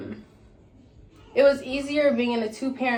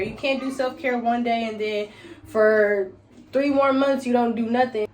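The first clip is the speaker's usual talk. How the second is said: ordinary talk, nothing marked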